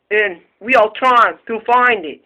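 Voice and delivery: normal talking voice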